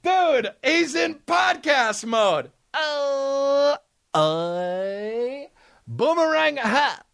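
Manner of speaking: high, drawn-out goofy cry; silly hyped voice. Pretend. Host announcing podcast mode, high and silly. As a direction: stretch it out in a high put-on voice. Puts on hyped voice after bit.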